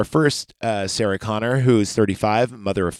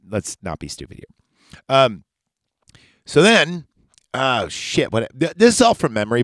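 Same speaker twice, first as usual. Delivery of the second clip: normal talking voice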